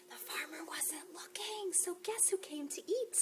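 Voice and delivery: hushed suspense whisper. Acted. Librarian whispering the rhyme to children. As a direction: whisper like telling a secret.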